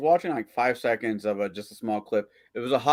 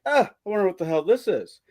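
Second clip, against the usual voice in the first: puzzled; 6 semitones higher than usual. Acted. Joke character finds a lamp.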